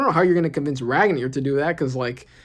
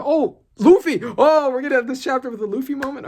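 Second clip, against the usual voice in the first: much higher, louder gasp of delight; excited surprise; 10 semitones higher, 6 dB louder than usual. Real, not acted. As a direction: greet a surprise like a thrilled fan. Luffy appears unexpectedly.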